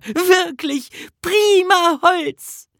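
very high, drawn-out, loud delight; overjoyed call. A narrator's reading. Nisse praises the wood Bertil brought, overjoyed. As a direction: savour it slowly in a high, happy cry. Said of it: "rief er".

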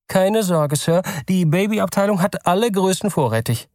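polite clerk. Acted. Store clerk asks the nervous father, polite and high.

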